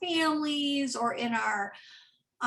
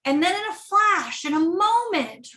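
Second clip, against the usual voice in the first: dramatic emphasis; 6 semitones higher, 5 dB louder than usual. Acted. Dramatic turn in the sermon.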